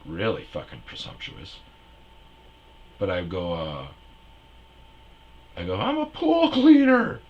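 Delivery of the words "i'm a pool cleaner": very high, loud panicked squeak; high squeaky self. Retold or imitated. Comic mocking his own answer, high squeaky blurt. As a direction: blurt it in a nervous falsetto.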